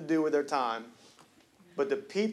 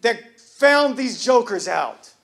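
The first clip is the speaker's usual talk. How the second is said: full-force preaching; 5 semitones higher, 7 dB louder than usual. Acted. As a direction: preach it, building to the crowd's amens.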